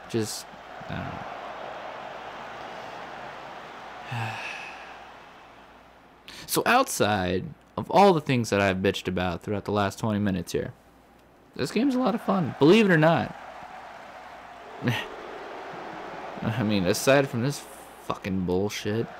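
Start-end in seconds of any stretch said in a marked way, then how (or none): 1.2-5.0 long exasperated exhale; long weary sigh. Real, not acted. Worn-out gamer sighing at the screen, long and weary. Exhausted after the blunders. 11.6-13.4 much higher, louder sarcastic lilt; ironic praise. Real, not acted. After a rant about the game, wryly high praise. 13.4-16.4 long resigned exhale; weary sigh. Real, not acted. Gamer sighing after his rant, long and resigned.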